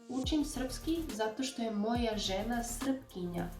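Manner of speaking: ordinary talk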